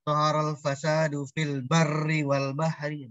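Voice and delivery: slow recited Arabic verse; Quranic recitation. Shown as a demonstration. Teacher quoting a verse to the class in a slow recitation. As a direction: recite the verse slowly and evenly. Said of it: "Barun artinya daratan".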